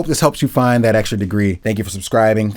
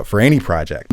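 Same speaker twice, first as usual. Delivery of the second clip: ordinary talk, nothing marked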